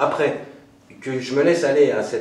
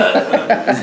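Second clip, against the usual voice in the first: short, loud laugh; brief burst of real laughter; 8 semitones higher, 7 dB louder than usual. Real, not acted. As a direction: break into a quick loud laugh. Laughs at own remark.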